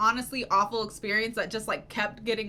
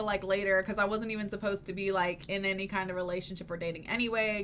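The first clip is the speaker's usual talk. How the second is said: usual speaking voice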